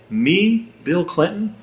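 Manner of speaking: put-on pinch-yourself wonder in a Southern accent; mock disbelief. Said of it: "I say".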